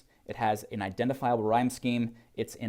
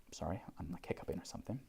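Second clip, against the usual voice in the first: quiet aside; 5 semitones lower, 16 dB quieter, faster than usual. Real, not acted. Aside during poem reading.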